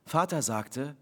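ordinary delivery, nothing marked

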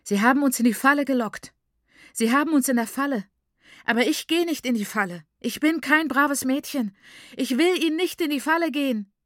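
high, loud, furious outburst; raging, frantic protest; fit of rage. A narrator's reading. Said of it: "verging der Wutanfall wieder".

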